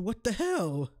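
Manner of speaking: high, fed-up mock curse; frustrated. Griping that Spectrum isn't available, in high frustrated cursing. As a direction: vent the letdown up high. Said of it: "I'm like".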